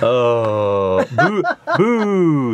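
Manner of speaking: long, playful groan into boos; mock booing. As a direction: boo like a disappointed crowd. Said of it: "what's the opposite to a woo".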